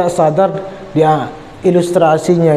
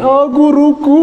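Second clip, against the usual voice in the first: relieved joyful cry; 9 semitones higher, slower than usual. Acted. Drowning student greets his teacher.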